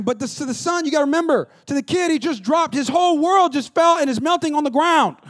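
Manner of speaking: normal talking voice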